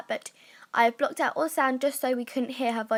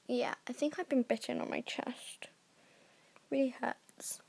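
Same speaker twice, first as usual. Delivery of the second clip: hushed whispered aside; soft pained whisper; 9 dB quieter than usual. Real, not acted. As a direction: whisper it under your breath. Aside about a bite.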